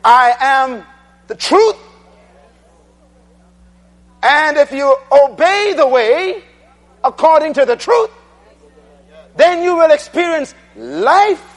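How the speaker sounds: full-force preaching. Acted. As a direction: build it up to the congregation.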